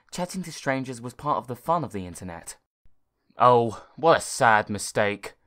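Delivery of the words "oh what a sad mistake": slow, louder computer voice; slow scolding robot voice; talking computer. Acted. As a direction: lecture the boy like a stern machine. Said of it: "said the computer".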